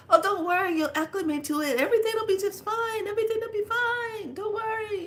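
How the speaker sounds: high, soothing, singsong comfort; calm her down kindly; high lilting voice of elders reassuring a newcomer. Retold or imitated.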